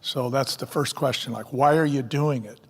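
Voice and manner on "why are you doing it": usual speaking voice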